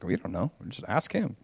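very soft, low mock-timid aside; evasive parents. Parents passing questions to their son, evasive and hushed. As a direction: back out of it quietly, low and small.

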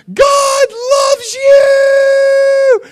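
very high, loud, drawn-out happy call; joyful yell. Retold or imitated. Happy teen calling to kids from car, joyful yell. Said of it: "yelling at these kids in a nice way".

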